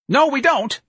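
very high, loud, shrill denial; shrill answer. A narrator's reading. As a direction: shut the caller down shrilly. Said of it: "answered in a shrill voice".

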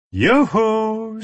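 high, held-out calling shout; shouted hail. A narrator's reading. Enemy hails the trapped group from down below, shouting. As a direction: call up to them in a long, high hail. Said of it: "someone shouted from down below".

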